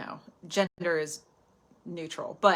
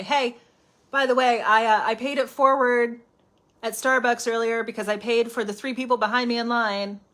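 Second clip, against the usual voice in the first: louder, bragging show-off voice; mock bragging; 2 semitones higher, 6 dB louder than usual. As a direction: announce your good deed for praise. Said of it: "you don't have to say".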